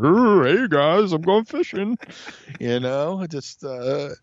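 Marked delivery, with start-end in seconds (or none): 0.0-2.0 high, slow sing-song hey guys; goofy Bigfoot. Retold or imitated. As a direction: ham up a dopey passer-by. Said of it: "just like".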